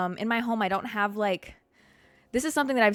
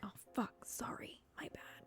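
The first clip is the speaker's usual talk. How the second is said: whispered apology; 21 dB quieter than usual. Retold or imitated. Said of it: "I'm like".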